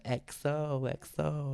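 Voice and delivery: high, drawn-out Vine voice; slow, high imitation of a viral clip; Vine impression. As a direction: stretch each letter out in a high put-on voice. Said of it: "you ever seen the Vine when it's like".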